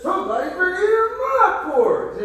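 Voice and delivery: preacher playing Daddy Bear. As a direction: bellow it as the big bear. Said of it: "the Daddy Bear says".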